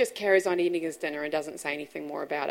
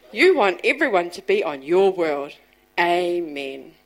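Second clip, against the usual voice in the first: put-on loud child's prayer ending; 9 dB louder than usual. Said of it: "he says to God".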